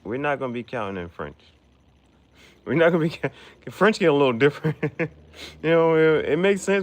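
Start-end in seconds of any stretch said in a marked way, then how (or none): 2.6-6.8 higher and louder through laughter; amused, higher and louder; laughing. Real, not acted. Laughing at French numbers.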